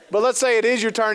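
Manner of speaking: usual speaking voice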